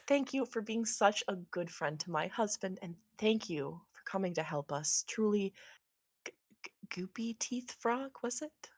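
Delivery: soft, polite, composed thanks; hushed courtesy smoothing over disgust; smiling wife. Acted. Wife thanks the slimy frog, softly polite. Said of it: "smooths it over with that same smile".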